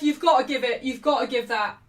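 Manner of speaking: loud demand to the ref, easing off; urgent fan's protest coming down from a yell; insistent appeal. Real, not acted. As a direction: keep pressing the ref as the shout subsides.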